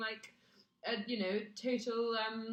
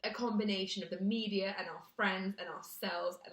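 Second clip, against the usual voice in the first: ordinary talk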